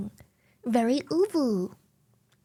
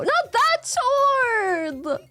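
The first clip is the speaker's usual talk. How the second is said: playful protest; 8 semitones higher, much slower than usual. Real, not acted.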